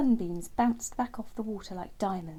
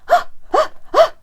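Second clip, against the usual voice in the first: three quick sharp gasps of fright; startled gasps; 18 dB louder than usual. Acted. Terence startled by the frog's tongue, sharp gasps. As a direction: gasp as a bird jumps in fright. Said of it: "gasped a surprised Terence".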